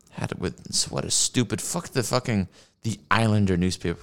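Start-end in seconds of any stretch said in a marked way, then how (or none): none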